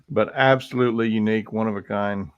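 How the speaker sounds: ordinary delivery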